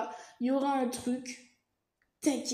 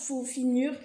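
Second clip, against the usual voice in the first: ordinary talk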